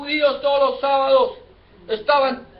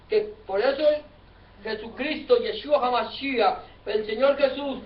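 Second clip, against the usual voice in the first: ordinary talk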